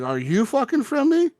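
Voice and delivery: high-pitched, urgent question; alarmed question. Retold or imitated. Player confronting a stranger, high alarmed demand. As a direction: demand it, voice jumping up.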